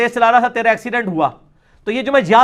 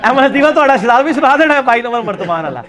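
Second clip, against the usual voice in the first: laughing; 4 semitones higher, 4 dB louder than usual. Real, not acted. Laughing at own joke.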